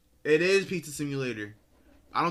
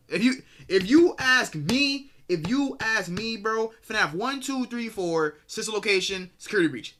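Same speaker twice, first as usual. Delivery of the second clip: emphatic declaration; 7 semitones higher, 5 dB louder than usual. Real, not acted. Declaring canon games.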